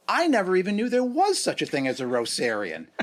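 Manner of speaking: loud, high-pitched incredulity; amused astonishment. Retold or imitated. Telling a friend about an unknown word, amused astonishment. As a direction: exclaim it in amused disbelief.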